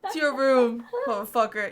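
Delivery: laugh, then throw him out of the room; mock kicking out. Playfully throwing out a useless partner, with a loud laughing mock dismissal.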